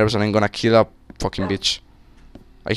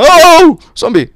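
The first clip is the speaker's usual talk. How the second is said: high, loud yelp of alarm; sudden high-pitched shout; startled yell; 9 dB louder, slower than usual. Real, not acted. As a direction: cry out as it jumps at you. Startled by a zombie in game.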